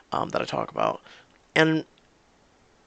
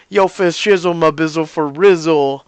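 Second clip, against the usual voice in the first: loud hammed-up slang talk; exaggerated joke slang voice; mock American slang; 3 semitones higher, 9 dB louder than usual. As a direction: parody street slang, loud and punchy. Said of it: "we've got to".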